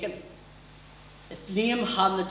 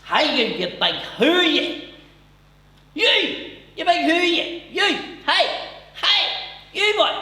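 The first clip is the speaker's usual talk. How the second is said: high, loud hollering across a room; loud Newry heckler; 12 semitones higher, 7 dB louder than usual. Acted. Newry stranger calling across a London bar, high loud shouting. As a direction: bellow for attention in bursts. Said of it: "out of nowhere I heard this thing going".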